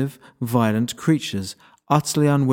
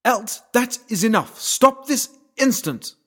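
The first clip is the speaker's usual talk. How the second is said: commanding shout; 13 semitones higher than usual. A narrator's reading. Lara shouting an order to the wrecking unicorn. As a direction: stop him dead with a sharp order. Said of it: "Lara shouted".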